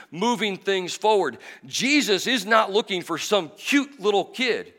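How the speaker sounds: loud, high, driving sermon voice; full-force preaching. Acted. Pastor preaching to the church, loud and driving. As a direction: preach it out hard to the congregation.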